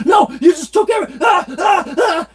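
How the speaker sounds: high, loud, fast shouting that dissolves into cries; mock panicked yell. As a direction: imitate dad freaking out. Imitating father's panicked refusal.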